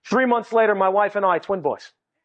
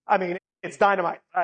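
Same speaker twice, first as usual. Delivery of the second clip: usual speaking voice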